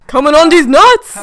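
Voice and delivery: loud comic shout. Acted. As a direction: yell it out as a punchline. Shouted punchline joke.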